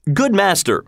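high, mild storybook exclamation; crying out. A narrator's reading. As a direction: lift the pitch to call out. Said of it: "cried Will".

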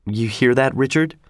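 ordinary delivery

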